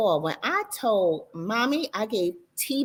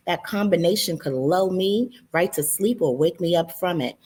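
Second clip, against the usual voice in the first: ordinary delivery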